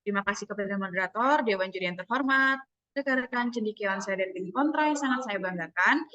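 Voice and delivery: measured debate greeting with a steady rhythm; slow, controlled opening. Shown as a demonstration. Debater thanking moderator and jury, calm and unhurried. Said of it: "dari awal tuh udah pelan aja gitu".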